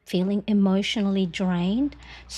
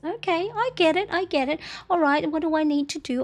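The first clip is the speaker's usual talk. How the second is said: higher, quick "I get it" of self-acceptance; resigned realization; 8 semitones higher, faster than usual. Retold or imitated. Mom realizing her cycle explains her mood, brisk. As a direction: wave it off and move on briskly. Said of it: "And then I'll go".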